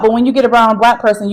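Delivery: ordinary delivery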